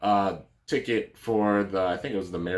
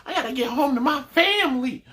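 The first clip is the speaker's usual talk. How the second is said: much higher voice, pleading she has to get home; high, shrill-pitched upset woman; 3 dB louder than usual. Retold or imitated. Stranded passenger begging the receptionist, in a much higher voice. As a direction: play a frantic stranded passenger. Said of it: "she was like".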